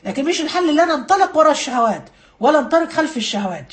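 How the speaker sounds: ordinary delivery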